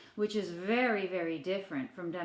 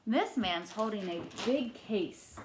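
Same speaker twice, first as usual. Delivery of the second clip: usual speaking voice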